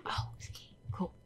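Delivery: whispering. Real, not acted. Hiding quietly from killer.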